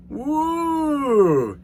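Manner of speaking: a high, loud gleeful woo; triumphant whoop. Retold or imitated. Bragging to viewers about sneaking in, high gleeful whoop. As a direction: whoop like you got away with it. Sneaking into first class bathroom.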